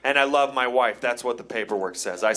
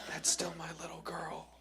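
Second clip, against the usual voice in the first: hushed whisper; bride's dad's warning; 4 semitones higher, 14 dB quieter than usual. Acted. Bride's dad to the groom at the wedding, warning whisper. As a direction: whisper it as a quiet claim on her. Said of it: "He's giving me a look. ... That look is".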